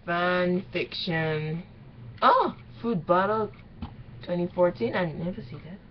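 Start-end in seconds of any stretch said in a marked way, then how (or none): none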